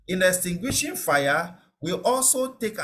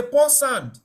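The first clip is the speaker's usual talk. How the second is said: high, louder call to pour sand; mock shout; 8 semitones higher, 4 dB louder than usual. Bystander at a fire urging sand, mock call. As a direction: urge someone to pour sand. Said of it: "And they say".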